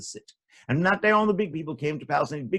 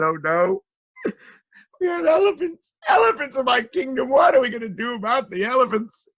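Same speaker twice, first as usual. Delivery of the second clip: high, loud mock wailing; sad king; 10 semitones higher, 6 dB louder than usual. A narrator's reading. King overrun with elephants, loud tearful lament. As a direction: wail in exaggerated royal despair. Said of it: "the king was very sad".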